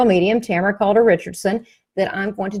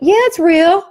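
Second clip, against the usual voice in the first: higher and louder, yeah rising bright; emphatic yes; 8 semitones higher, 7 dB louder than usual. Retold or imitated. Medium confirming to the client it's real, loud and excited. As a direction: answer with big triumphant certainty.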